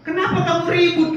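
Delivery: high, loud, quick puzzled question; Jesus asking, incredulous. Retold or imitated. Preacher voicing Jesus to the mourners, high and baffled.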